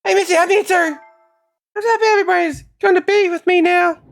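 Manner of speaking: put-on high happy girl voice. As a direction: coo it like a relieved child. Voicing girl character, happy.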